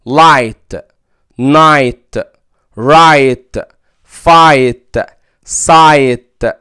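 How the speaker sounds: light, night, right with a clear ai; long i before gh. Shown as a demonstration. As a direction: model igh words one by one. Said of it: "جي اتش".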